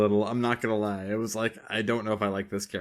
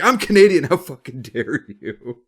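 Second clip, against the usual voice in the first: mock Canadian outrage, high and loud then slow; hammed-up indignation, dragging the last words; 4 semitones higher, 10 dB louder, slower than usual. Offended Canadian scolding an American, mock outrage. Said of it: "was like".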